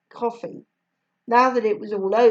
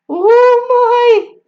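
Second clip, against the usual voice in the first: much higher, louder Jamaican mum gasping from laughter; Jamaican mother laughing; 13 dB louder than usual. A narrator's reading. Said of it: "said Missus Ribeiro".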